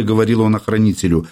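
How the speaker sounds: ordinary delivery, nothing marked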